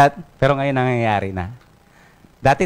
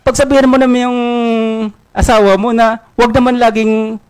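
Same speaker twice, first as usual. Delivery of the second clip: higher, louder nagging complaint; exasperated scolding; 8 semitones higher, 3 dB louder than usual. Acted. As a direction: scold your coworker loudly. Pedro scolds Juan over lunch. (pasigaw na pagsermon, mas mataas at malakas)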